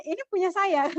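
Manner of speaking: lightly mock self-assured; retold confidence. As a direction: playfully imitate someone confidently staking a claim. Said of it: "si Zakaria dengan pedenya".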